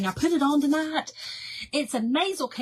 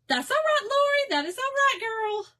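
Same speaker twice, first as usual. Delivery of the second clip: much higher, lilting reassurance; sing-song greeting; 13 semitones higher than usual. Real, not acted. Reassuring viewer Lori, high and sing-song. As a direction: sing it high to comfort a friend.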